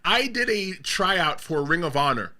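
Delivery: ordinary talk, nothing marked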